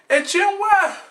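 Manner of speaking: high wailing "why"; hammed-up wail at producer Jim; pleading cry. Real, not acted. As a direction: cry out to Jim in mock despair. Crying out to Jim.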